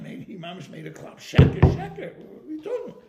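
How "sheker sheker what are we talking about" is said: sharp high cry that falls off at the end; outraged protest. Retold or imitated. Rabbi refusing praise at his honor meeting, high outraged cry. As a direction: cry out against the praise, then drop away.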